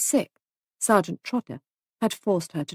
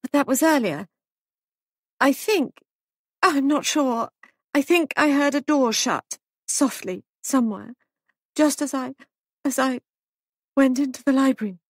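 high, hesitant, halting woman's voice; shaken young wife; 7 semitones higher than usual. A narrator's reading. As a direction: grope through the memory in broken phrases. Shaken Molly questioned by sergeant.

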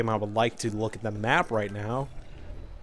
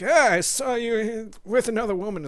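mock jealous accusation; 9 semitones higher than usual. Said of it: "it's like".

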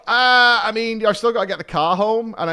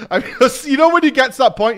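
laughing, louder and higher, fast; 10 semitones higher, 10 dB louder, faster than usual. Real, not acted. Teller cracking up at his own story. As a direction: burst out laughing through it.

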